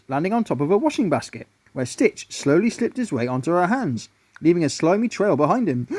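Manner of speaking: plain reading voice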